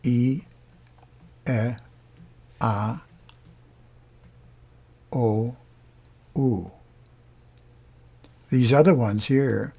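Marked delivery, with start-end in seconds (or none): 0.0-6.7 clean pure vowels, each held apart; Italian vowels. Shown as a demonstration. Teacher demonstrating Italian vowels for singers. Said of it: "Italian vowels".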